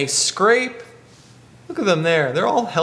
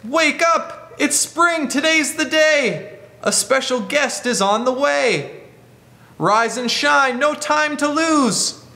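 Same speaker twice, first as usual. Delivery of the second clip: put-on higher, excited little boy voice; higher wake-up call from William; 6 semitones higher, slower than usual. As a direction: call the animals awake brightly. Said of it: "he says".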